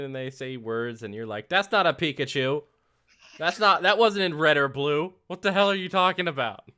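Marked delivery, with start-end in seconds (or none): 1.5-6.7 loud, high mock outrage; indignant retort. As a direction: play up exasperated disbelief at the kids. Acted indignant retort to kids about Pokemon.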